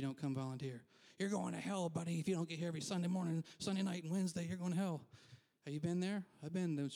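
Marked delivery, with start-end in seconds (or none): 1.2-5.0 mock hellfire threat, rattled off; threatening preacher. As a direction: imitate a hellfire preacher, higher and urgent. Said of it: "they threaten you with hell every Sunday".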